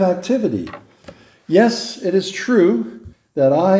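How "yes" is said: ordinary delivery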